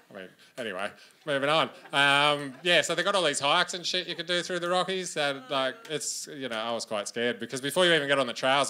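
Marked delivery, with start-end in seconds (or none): none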